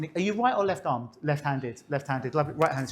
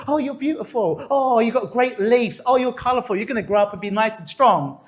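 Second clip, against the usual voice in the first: high, gushing praise to a plant; gushing mock praise, pitched way up; sweet-talking plants; 5 semitones higher, 3 dB louder than usual. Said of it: "they would say positive words".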